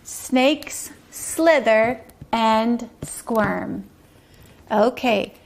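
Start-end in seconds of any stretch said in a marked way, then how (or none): 0.0-3.8 very slow, one word at a time; slow message reading. Shown as a demonstration. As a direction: say each word separately, with clear pauses. Reads back written message.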